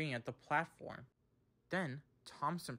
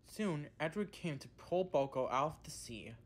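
ordinary delivery, nothing marked

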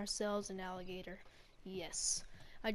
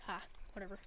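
usual speaking voice

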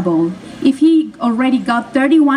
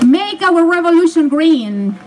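rousing rally cry; 6 semitones higher than usual. Real, not acted. Candidate rallying the crowd at the end of her speech, rousing slow rally cry. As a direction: lift the crowd on the closing slogan.

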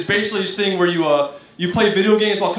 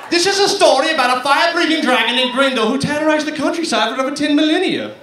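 higher mock British narrator voice; storybook narrator; 5 semitones higher, faster than usual. British gamer narrating a dragon legend, hammed-up storyteller. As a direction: tell it like a grand fantasy tale. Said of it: "you're about to narrate a story".

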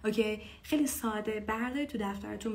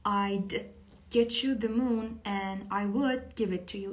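slow clear lyric; much slower than usual. Shown as a demonstration.

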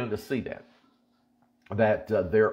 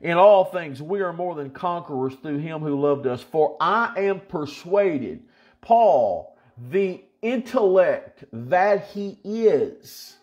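raised, rhythmic scripture preaching; 5 semitones higher, slower than usual. Acted. Preacher reading Paul's list to believers, rhythmic. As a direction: build the list of nors steadily.

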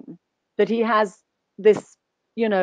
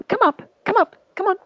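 higher, eager coaxing call; calling the dog; 10 semitones higher, faster than usual. Real, not acted. Calling the dog Louis up onto the stage, eager and higher. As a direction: coax the dog up to you, higher.

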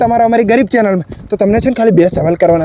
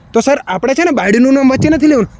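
usual speaking voice

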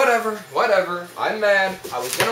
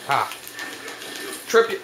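ordinary talk

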